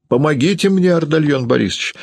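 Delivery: high-pitched appeal for help; agitated cry to an ally; woman crying out. A narrator's reading. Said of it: "закричала Юлия".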